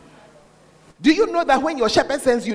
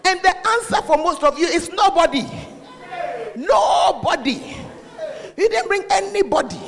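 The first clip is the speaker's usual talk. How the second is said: scolding, raised voice; 6 semitones higher than usual. Acted. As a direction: call them out, voice raised high. Preacher scolding congregation.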